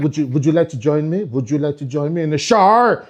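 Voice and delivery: tempting invitation, mock accent; mixed French accent. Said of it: "she'll be like".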